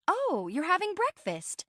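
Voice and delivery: high, delighted exclamation; bubbly friend. Acted. Friend notices her housemate is having breakfast, bubbly. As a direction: pitch it way up, cheerfully.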